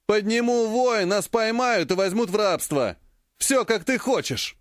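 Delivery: high, taunting threat; mocking threat. A narrator's reading. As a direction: needle him with a sneer. Key threatens to raise alarm.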